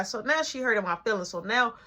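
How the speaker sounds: ordinary delivery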